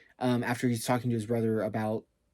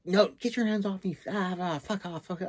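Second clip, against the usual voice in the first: high-pitched imitated scream, much higher; retold screaming; 8 semitones higher than usual. Ashwin fighting off her kidnappers, an imitated high scream. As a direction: mimic a woman fighting off attackers, pitched way up. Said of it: "I hear Ashwin, like, screaming".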